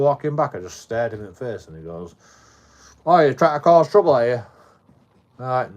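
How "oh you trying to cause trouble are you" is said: very loud, high mimicked challenge; confrontational accusation. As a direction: imitate an aggressor accusing a passer-by. Aggressor's retold accusation.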